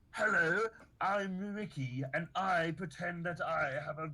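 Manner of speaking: mocking upper-class British accent; snooty British voice. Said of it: "nobody talks to me like".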